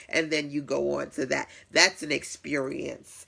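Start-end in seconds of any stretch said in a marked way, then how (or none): none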